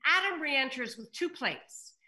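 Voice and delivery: ordinary talk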